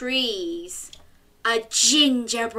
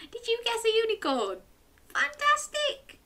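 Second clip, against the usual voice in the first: excited; 14 semitones higher than usual. Real, not acted. Praising children's guesses.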